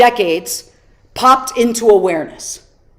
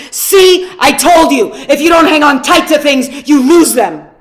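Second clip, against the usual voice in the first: louder, sharp scolding father; angry tone; 3 semitones higher, 5 dB louder than usual. Retold or imitated. Dad scolding his son who let go of the balloon, angry and louder. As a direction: scold the kid, pointing at him. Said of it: "in an angry tone".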